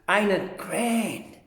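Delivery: usual speaking voice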